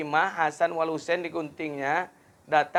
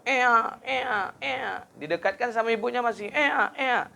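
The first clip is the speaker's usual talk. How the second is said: high squealing newborn wail ea ea; mock baby cry; 9 semitones higher than usual. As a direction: imitate a crying newborn, pitched way up. Imitating newborn baby crying.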